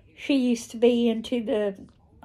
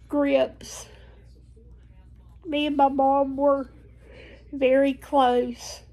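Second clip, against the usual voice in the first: tearful; 3 semitones higher than usual. Real, not acted. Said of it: "I met my quota for blubbering today".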